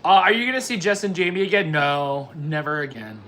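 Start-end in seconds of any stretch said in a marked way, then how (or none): none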